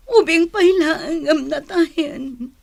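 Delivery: crying over a nephew's death; breaking into tears. Acted. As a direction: sob out the words.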